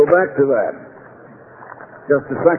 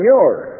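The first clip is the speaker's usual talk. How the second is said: "your" punched and held; stress on your; 3 semitones higher than usual. Shown as a demonstration. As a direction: hit the word "your" hard. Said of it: "I was emphasizing the word".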